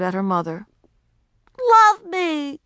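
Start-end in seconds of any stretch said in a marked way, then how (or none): 1.7-2.6 high, loud hurt demand; loud high-pitched wounded plea; fierce with hurt. A narrator's reading. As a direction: cry out to her mother. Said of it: "Her voice was fierce with hurt".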